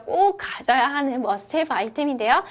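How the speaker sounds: usual speaking voice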